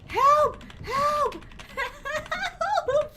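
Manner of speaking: high, loud mock-panicked help; half-laughing cries for help. Real, not acted. Streamer shouting for help between laughs. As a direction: yell for help, giggling through it.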